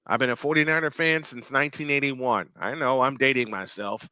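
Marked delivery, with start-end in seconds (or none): none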